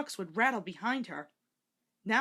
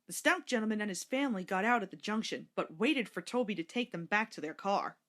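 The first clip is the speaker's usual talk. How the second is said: plain reading voice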